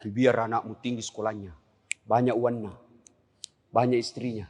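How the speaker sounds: ordinary delivery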